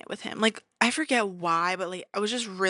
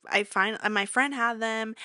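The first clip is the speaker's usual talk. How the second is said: usual speaking voice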